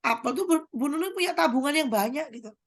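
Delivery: usual speaking voice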